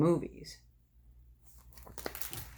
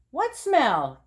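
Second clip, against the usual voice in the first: innocent denial; 7 semitones higher than usual. Acted. Froggy plays innocent.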